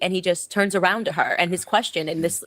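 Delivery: ordinary talk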